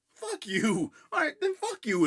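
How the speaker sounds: mock angry retort. Friends fed up with being blamed, high mock-angry retort. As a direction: yell back in hammed-up indignation.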